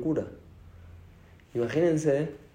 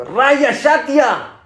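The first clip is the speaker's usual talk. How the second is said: much higher, louder, very slow scolding; stern rebuke; 12 semitones higher, 7 dB louder, much slower than usual. Retold or imitated. Hashem rebukes Noah, slow loud scolding. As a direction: drag out the insult like a judge. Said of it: "Antes de eso le dijo".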